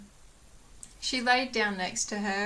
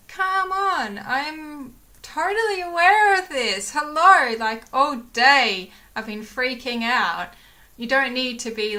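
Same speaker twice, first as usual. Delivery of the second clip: shouted, much higher and much louder, indignant; angry rant; 4 semitones higher, 10 dB louder than usual. Retold or imitated. Retelling snapping back at a curt vet, in a loud high rant.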